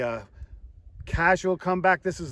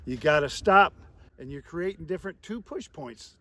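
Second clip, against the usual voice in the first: ordinary talk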